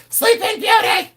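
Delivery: very high-pitched squealed scream; mock scream. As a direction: imitate resellers screaming the answer. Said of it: "they scream".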